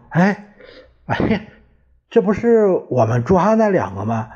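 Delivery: high, fast mock astonishment; feigned surprise. A narrator's reading. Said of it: "二人故作惊讶". (故作惊讶，声音高而快)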